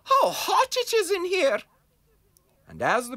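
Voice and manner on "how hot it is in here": hot badger complaining.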